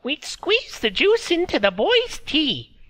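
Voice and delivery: put-on high, thin old woman's voice; old woman speaking through a laugh; old Meredy. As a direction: voice her as a frail old village woman. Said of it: "She laughed again, remembering".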